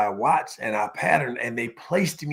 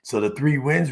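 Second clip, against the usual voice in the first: ordinary talk, nothing marked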